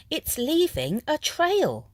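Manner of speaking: put-on high, wondering kids' voice; excited children. A narrator's reading. Children noticing the snail's trail, high and excited. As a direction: point it out in delight.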